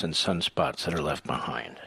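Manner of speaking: hushed aside; whispering. Acted. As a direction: drop to a secretive whisper. Ad aside, unvoiced.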